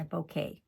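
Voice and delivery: "bouquet" ending in a clear "kay"; self-corrected pronunciation. Real, not acted. Crafter corrects her own slip, "bouquet" as boh-KAY. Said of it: "it's bouquet".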